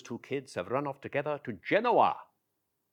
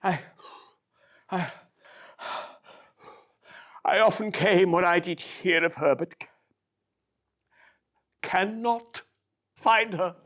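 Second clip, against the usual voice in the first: out of breath; 7 semitones higher, 7 dB louder than usual. Acted. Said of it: "I'm a little bit out of breath".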